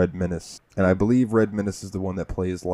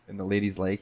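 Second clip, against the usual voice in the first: normal talking voice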